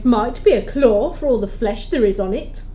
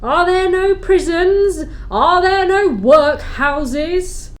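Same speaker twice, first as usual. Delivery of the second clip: higher, louder, throwing his words back; mocking echo; 8 semitones higher, 4 dB louder, slower than usual. Spirit mocking Scrooge with his own cruel words.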